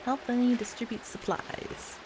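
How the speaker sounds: usual speaking voice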